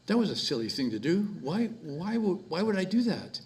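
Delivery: mom's voice. Retold or imitated. Mom voiced in story.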